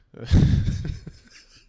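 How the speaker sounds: loud unvoiced laugh; burst of laughter. Real, not acted. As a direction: break into a laugh. Laughing at own remark.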